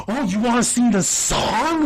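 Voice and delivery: exaggerated sarcastic surprise; mock incredulity. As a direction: throw it back in their face, drawing out the end. Acts Charlie mocking Dee.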